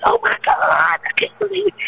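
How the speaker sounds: high, shocked squeal; hammed-up astonishment; mock disbelief. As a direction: play it as over-the-top disbelief. Mock shocked reaction to news.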